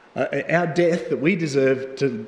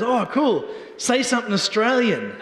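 higher, excited American waiter; lightly voiced eager waiter; a hint of an excited American waiter; imitating the waiter; 7 semitones higher than usual. Waiter voiced in retold story.

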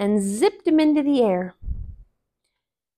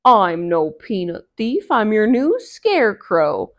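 Brer Bear; 8 dB louder than usual. Acted. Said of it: "said Brer Bear".

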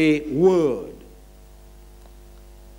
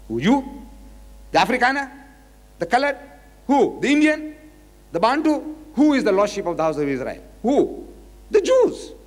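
mocking rhetorical questions; 7 semitones higher than usual. Lecturer teasing the crowd with rising mock questions. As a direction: pitch climbing high on each name. Mocking roll call of nations.

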